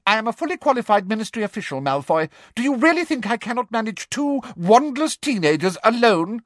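high, fast indignant rebuke; offended official; sharp. A narrator's reading. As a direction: cut him off haughtily. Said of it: "asked Umbridge sharply".

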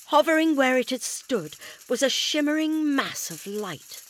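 ordinary delivery, nothing marked

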